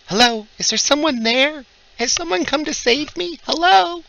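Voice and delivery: high, pleading woman's call; damsel calling for help. Acted. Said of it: "a woman's voice calling out in common".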